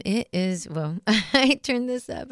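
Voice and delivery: normal talking voice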